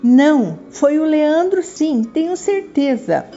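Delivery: put-on high, insistent little girl voice; stubborn demanding girl. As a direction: refuse to back down, high and pushy. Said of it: "continuava irredutível".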